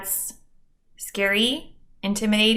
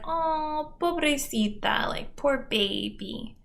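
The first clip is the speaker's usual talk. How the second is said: pity voice; 5 semitones higher, 5 dB quieter than usual. Retold or imitated.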